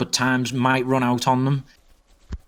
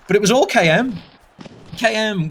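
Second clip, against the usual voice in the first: excited; 9 semitones higher than usual. Real, not acted. Goal replay excitement.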